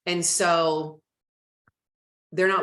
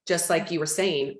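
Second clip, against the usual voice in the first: ordinary delivery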